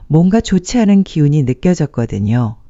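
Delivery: usual speaking voice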